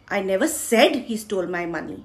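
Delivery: said is stressed, implying it was only hinted. Shown as a demonstration. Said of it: "मैंने बोला नहीं, पर उसी ने चुराया है". (said पर ज़ोर, इशारा किया पर कहा नहीं)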